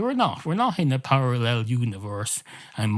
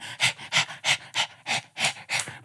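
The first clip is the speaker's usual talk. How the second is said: voiceless, rhythmic dog pants; laughing dog panting; 3 dB quieter than usual. Acted. One dog mocking another's laugh, panting. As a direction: pant with mouth open like a laughing dog. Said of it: "impression of a laughing dog".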